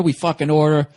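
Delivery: ordinary delivery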